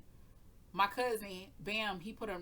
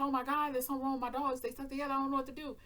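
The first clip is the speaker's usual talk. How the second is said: higher, louder panicked plea; retold panic; 6 semitones higher, 3 dB louder, faster than usual. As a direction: blurt it out to the neighbor in a panic. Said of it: "I said".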